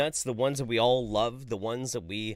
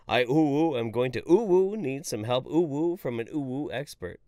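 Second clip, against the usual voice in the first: higher and louder, ooh between every word; hammed-up anime uwu voice with breathy oohs; uwu moaning; 4 semitones higher, 4 dB louder than usual. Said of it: "Too much uwu".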